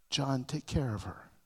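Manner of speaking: low, soft dying request; hushed tender charge to John; quiet. Retold or imitated. Jesus on the cross.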